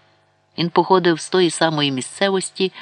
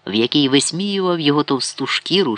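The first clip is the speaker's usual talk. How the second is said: plain reading voice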